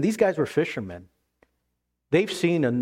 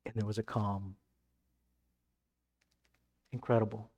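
much softer and lower, letting the calm settle; hushed calm; 5 semitones lower, 10 dB quieter than usual. Acted. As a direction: drop to a hushed voice as the storm ends. Calm after the storm.

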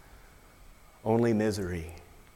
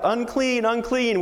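much higher, ringing call of unclean; pretend warning cry; 10 semitones higher than usual. Infected person in lockdown warning others off, high ringing cry. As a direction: call out a warning so people keep away. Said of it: "infected people had to cry out".

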